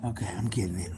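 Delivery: low whisper to herself; whispered to self. Real, not acted. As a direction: murmur it while busy. Muttering while busy.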